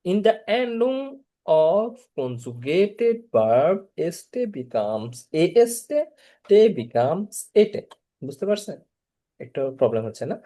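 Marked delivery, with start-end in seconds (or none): 0.0-7.8 every word drawn out slowly, spelling out the rule; slow rule reading. Shown as a demonstration. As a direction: dictate it slowly so students can copy. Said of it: "teacher reading the rule slowly". (ধীরে ধীরে নিয়ম বলা)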